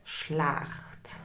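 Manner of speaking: low, breathy "Schlecht?"; glum. Shown as a demonstration. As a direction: let "Schlecht?" sink low and soft. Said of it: "acts out answer options". (niedergeschlagen geflüstert)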